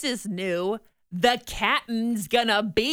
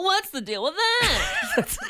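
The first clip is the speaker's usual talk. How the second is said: put-on German accent; 8 semitones higher, slower than usual. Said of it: "Is that your German accent?".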